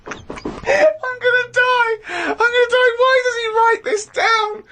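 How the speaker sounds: high, very slow, crying with laughter; dying of laughter, pleading; laughing. Real, not acted. Helpless laughter at diary.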